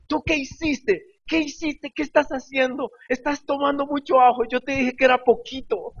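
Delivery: high-pitched imitation of an astonished wife; Paulita. Astonished wife questions crying husband, high mock scolding. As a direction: scold him in mock alarm, voice way up. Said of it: "Paulita estaba tan asombrada que ella lo único que dijo es".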